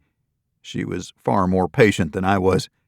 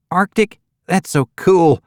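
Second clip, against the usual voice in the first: put-on much higher, brighter country girl clerk; cheerful young desk clerk greeting guests; 9 semitones higher, 5 dB louder than usual. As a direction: chirp it out like a perky receptionist.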